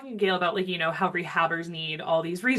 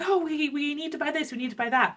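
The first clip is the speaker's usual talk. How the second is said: higher-pitched mock worry over buying things; imitating needy rehabbers, pitched up; mimicked fretting; 10 semitones higher than usual. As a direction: quote a fretful shopper in a raised pitch. Quoting what rehabbers say.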